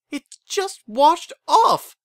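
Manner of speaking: very high, loud, slow squeak; shrill squeak. A narrator's reading. Flustered daughter explaining her missing makeup, shrill squeak. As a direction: squeak it out defensively, drawn out. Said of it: "she squeaked in a shriller than usual voice".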